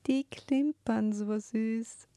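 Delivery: put-on high, slow, dreamy child voice; little girl. A narrator's reading. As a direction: admire them like a small child. Little daughter admires father's medals.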